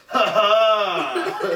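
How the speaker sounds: high, triumphant mock "ha ha"; performed gotcha laugh; loud fake laugh. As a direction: blast a gloating laugh in their face. Re-enacts cackling into someone's bubble.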